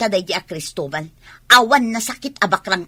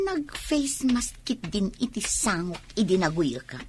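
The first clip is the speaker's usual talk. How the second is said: ordinary talk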